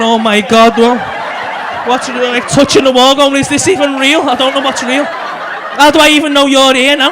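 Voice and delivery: loud bewildered stoner panic; mock panic. Stoned students touching the wall, loudly panicking. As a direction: act freaked out and unsure what's real.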